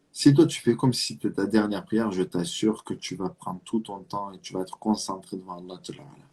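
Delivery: ordinary talk, nothing marked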